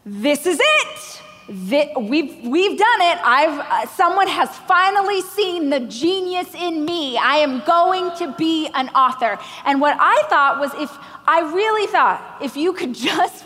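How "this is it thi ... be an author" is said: giddy self, higher and thrilled; breathless triumph, pitched up. Retold or imitated. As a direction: relive the moment the dream came true. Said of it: "I was like".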